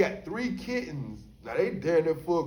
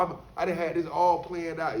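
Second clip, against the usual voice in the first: normal talking voice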